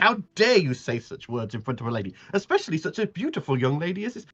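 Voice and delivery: put-on high, scandalised then doting old lady; Miss Polly's voice. GM as Miss Polly scolding Sandal and fussing over Finri, high. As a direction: scold the rude boy, then coo over the guest.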